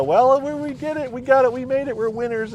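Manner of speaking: high, thrilled squeal of victory; retold winner's glee, pitched high; excited. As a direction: gush like you've just won. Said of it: "she was so excited".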